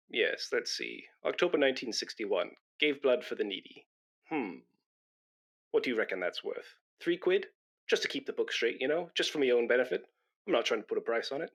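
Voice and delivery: low, soft mutter over the diary; quiet private calculation; muttering to himself. Real, not acted. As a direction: say it half under the breath, to yourself.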